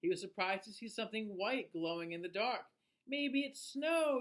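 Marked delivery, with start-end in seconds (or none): 3.1-4.2 higher, slow, wondering delight; excitedly. A narrator's reading. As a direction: stretch it out in wide-eyed delight. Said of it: "he said excitedly".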